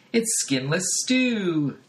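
lightly voiced cheerful wife; sitcom wife. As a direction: present the dish sweetly. Sitcom wife announces dinner.

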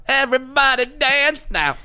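high slow club hype voice. Pretend. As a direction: belt it like a dance hit. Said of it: "like the male singer from C N C Music Factory".

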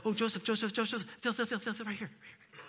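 soft, rapid repeated calls, fading away; hushed excitement. Acted. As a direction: urge your husband over in an excited hush. Acted Mary calling Joseph to feel.

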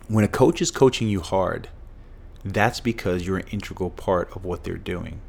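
ordinary delivery

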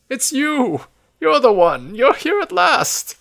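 put-on high, loud old man's cry; soaring, thrilled old-man voice; excited exclamation. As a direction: exclaim it like a long-awaited arrival. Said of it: "and then exclaims".

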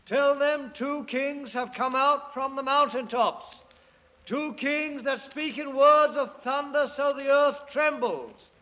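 self-crowned king proclaiming; grand proclamation. Acted. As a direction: announce it to the whole land. Proclaiming kings to the villagers.

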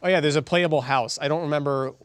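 normal talking voice

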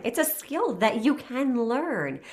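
usual speaking voice

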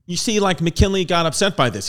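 normal talking voice

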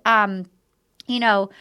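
ordinary delivery

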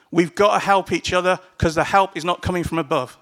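usual speaking voice

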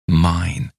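ordinary delivery

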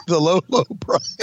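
laughing through the words; words broken by laughter. Real, not acted.

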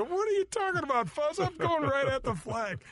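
high, protesting and incredulous, slowing on the last words; indignant disbelief. Retold or imitated. To Fuzzy in the bushes, high indignant protest. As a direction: push back, astonished at the accusation. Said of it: "And I go".

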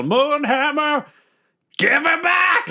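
high, slow commanding yell. Acted. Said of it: "I just yell".

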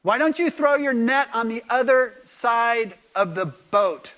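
loud, high, slow holler; drawn-out call across water; calling from shore. Acted. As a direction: shout slowly so the boat can hear. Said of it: "this voice on the beach says".

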